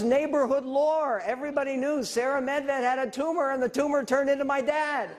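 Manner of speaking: high, projected excitement over audience laughter; proud, high-pitched comic reveal; delighted punchline delivery. Real, not acted.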